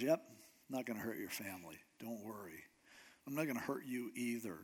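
soft, fast, offhand promise; low-key reassurance. Acted. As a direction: drop the voice and brush off his worry. David calmly reassures pleading Saul.

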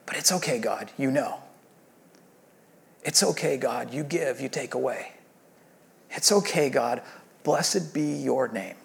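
soft, near-whispered surrender to God; hushed prayer. Acted. Preacher models a quiet prayer of surrender, soft and hushed. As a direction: breathe it out like a private prayer.

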